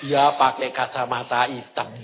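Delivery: low, unhurried divine answer; Tuhan. Retold or imitated. God answering the puzzled guide, low and matter-of-fact. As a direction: state it calmly, well down in pitch. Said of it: "Tuhan bilang apa?".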